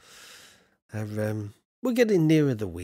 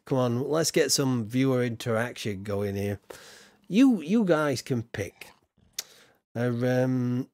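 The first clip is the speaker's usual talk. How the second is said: ordinary talk, nothing marked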